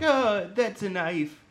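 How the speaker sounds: a hint of a goofy mayor; Mayor Bobaldoonery voice. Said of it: "Mayor Bobaldoonery voice".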